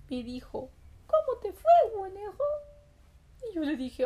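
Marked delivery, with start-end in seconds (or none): 1.1-2.4 mother's greeting. Acted. Mother greets returning rabbit.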